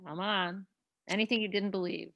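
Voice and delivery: ordinary delivery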